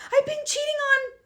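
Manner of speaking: high, tearful blurted confession, laughing through tears; a cousin's sudden tearful confession; bursting out, half laughing half crying. Retold or imitated. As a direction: blurt it out between laugh and sob, voice high and cracking. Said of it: "She bursts out and goes".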